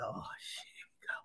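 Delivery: whispered dread under the breath; a dreading "oh shit" whisper. Retold or imitated. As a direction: whisper it like you've just been caught. Caught by the cop again.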